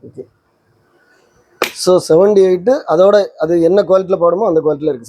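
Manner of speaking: usual speaking voice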